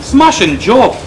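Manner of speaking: high, loud mock enthusiasm turning to complaint; imitated emphatic reply. Father voices daughter answering her professor, loud and emphatic.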